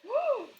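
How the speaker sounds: very high sudden gasp; big gasp. Real, not acted. Gasping at a viewer who ran out of coffee. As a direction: gasp in mock alarm. Seeing a chat message.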